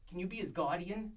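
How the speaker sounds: hammed-up Boston accent. Shown as a demonstration. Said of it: "I need to hear it".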